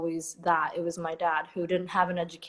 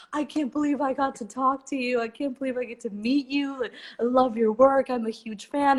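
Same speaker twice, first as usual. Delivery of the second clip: higher star-struck admirer; gushing fan; 6 semitones higher than usual. Retold or imitated. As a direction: gush like a starstruck fan. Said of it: "He's like".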